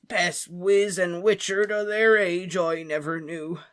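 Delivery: slow, slurred giant's lament; a tipsy gamekeeper, heavy with grief; drunk Hagrid. Acted. Drunk Hagrid toasting the dead at a wake, slurring.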